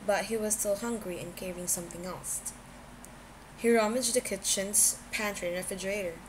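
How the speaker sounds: ordinary delivery, nothing marked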